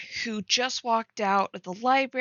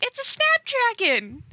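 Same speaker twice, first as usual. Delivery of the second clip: excited exclaim. Real, not acted. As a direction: announce your favorite flower with delight. Excitedly naming favorite flower.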